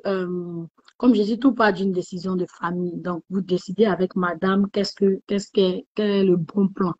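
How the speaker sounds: ordinary talk, nothing marked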